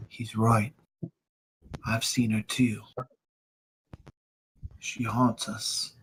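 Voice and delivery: soft, halting delivery with pauses; understated grim sorrow; subdued haunted son. Acted. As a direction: quietly confirm a painful family secret. Haunted son confirms the ghost.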